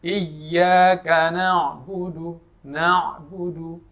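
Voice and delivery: slow, drawn-out tajwid chant; slow melodic Quran recitation. Shown as a demonstration. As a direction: recite it slowly like tilawah.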